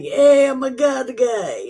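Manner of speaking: loud, high, eager "Hey, I'm a God guy"; mock eager believer. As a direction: pipe it up like a keen churchgoer. Mock believer calling out cheerfully.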